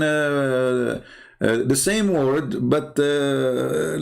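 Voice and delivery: ordinary delivery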